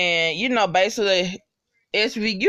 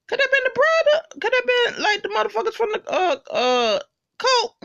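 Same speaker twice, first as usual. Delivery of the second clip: much higher, rushed and animated; excited speculation. Real, not acted. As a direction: blurt out the theory eagerly. Excited guess about the next episode.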